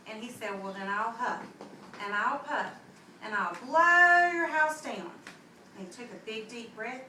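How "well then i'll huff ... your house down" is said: building wolf threat. Acted. Big bad wolf threatening the pig, building to a roar. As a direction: huff and puff up to a big roar.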